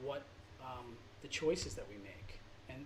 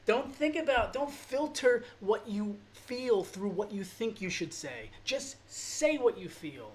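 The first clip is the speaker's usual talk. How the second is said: much higher and louder, pleading, slowed; emotional outburst; 6 semitones higher, 7 dB louder, slower than usual. Real, not acted. Pleading with viewers to say what they feel, emotional. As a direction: let the feeling burst through the words. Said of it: "Sorry, I'm getting emotional".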